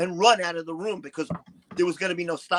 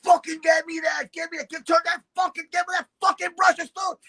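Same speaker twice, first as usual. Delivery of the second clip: retold yelling; 11 semitones higher than usual. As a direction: bark orders like a raging diva. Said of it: "yelling at people".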